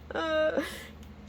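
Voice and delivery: a high burst of laughter; high delighted laugh. Real, not acted. Laughing at the death card.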